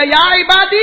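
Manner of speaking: loud, high, thundering summons; angry call. Acted. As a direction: shout it out in acted wrath. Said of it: "اب غصے میں اللہ کہے گا".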